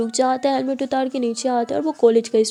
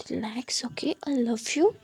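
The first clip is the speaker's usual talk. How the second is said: hushed, intimate reassurance; tender whisper; 7 dB quieter, slower than usual. A narrator's reading. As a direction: whisper lovingly to calm a partner. Soothing lover with whispered endearment. (प्यार भरी फुसफुसाहट)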